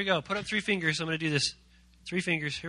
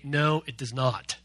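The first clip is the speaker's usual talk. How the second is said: slow, firm denial; 5 semitones lower, slower than usual. Shown as a demonstration. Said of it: "Turn to your neighbor and say".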